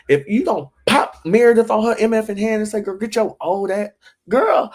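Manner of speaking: high, loud hammed-up indignation; mock outrage. Host urging castmates to confront a bully, mock-outraged. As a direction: climb high and loud as if egging her on. Acts out egging someone on against Meredith.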